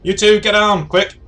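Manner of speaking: high, loud command; acted emergency shout; urgent warning. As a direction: shout them down to cover. Urgent warning to take cover.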